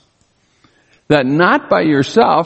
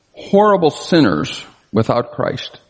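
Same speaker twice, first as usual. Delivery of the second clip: ordinary talk, nothing marked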